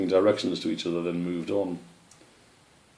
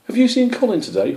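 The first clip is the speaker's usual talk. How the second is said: high, loud eager asking; bright young squirrel; 7 dB louder than usual. Acted. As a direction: call it out hopefully.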